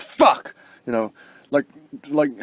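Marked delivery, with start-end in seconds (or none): 0.0-0.5 high, loud frustrated curse; hammed-up outburst of frustration; mock frustrated yell. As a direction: blurt it out like you just failed. Acting out frustrated aggressive person.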